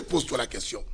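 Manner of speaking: breathy whisper under the sermon; a confiding whisper to the believer; whispered aside. Acted. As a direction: drop to a whisper to make them think. Aside to the listener.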